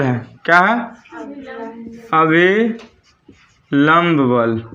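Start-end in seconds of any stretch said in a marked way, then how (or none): 2.1-4.6 very slow, every syllable stretched; slow drawn-out dictation. Shown as a demonstration. Teacher dictating to class, stretching the term slowly. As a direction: spell it out for students copying notes.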